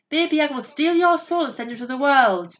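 high, loud howled warning; howling. A narrator's reading. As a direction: cry it out like wolves. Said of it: "howled the wolves".

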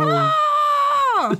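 high-pitched mock cry of dismay; mock scream. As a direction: let out a hammed-up high scream. Mock dismay at Federation guys.

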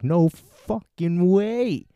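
slow disbelief. Retold or imitated. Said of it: "I'm like".

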